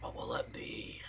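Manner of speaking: hushed breathy echo of the line; mock whisper. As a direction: whisper it back dramatically. Echoing the game's question in a whisper.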